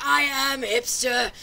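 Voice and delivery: high, loud, slow pompous proclamation; mock wannabe hipster. Mocking a wannabe hipster who forces it on you, slow and loud. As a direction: announce it like a self-important poser. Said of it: "forcing it on you".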